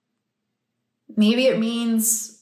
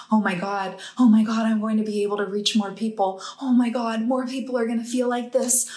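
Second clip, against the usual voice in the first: building awe and excitement; 2 semitones higher, faster than usual. Retold or imitated. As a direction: relive a mounting realisation. Relives mounting realisation in meditation.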